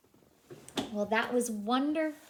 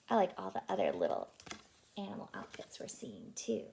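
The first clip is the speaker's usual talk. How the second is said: plain reading voice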